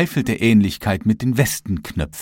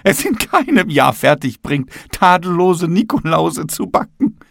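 lightly voiced higher character, excusing itself; a touch of high-pitched apology; apologetic ceiling; 7 semitones higher, 3 dB louder than usual. A narrator's reading. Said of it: "entschuldigte sich die Decke".

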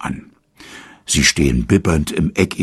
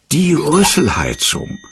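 much higher, bright call of a sudden idea; eager idea; 11 semitones higher than usual. A narrator's reading. Otto calls out his idea to the keeper, excited and higher. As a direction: blurt out the solution. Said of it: "ruft er".

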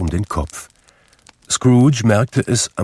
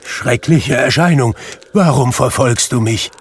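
loud, high-pitched appeal to a phantom; dramatic frightened outcry; crying out; 6 semitones higher, 5 dB louder than usual. A narrator's reading. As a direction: call out in horror, voice raised. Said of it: "rief er".

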